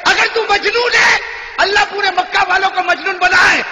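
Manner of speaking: preacher voicing the mother's prayer, crying out; fervent shouted prayer. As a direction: proclaim it like a prayer at full force.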